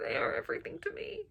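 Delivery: tender whispered devotion. Real, not acted. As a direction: hush it like a confession. Emotional whisper.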